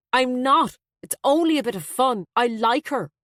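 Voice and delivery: sharp-toned son on the defensive. A narrator's reading. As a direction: snap back at the mother. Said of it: "Her son's tone was sharper".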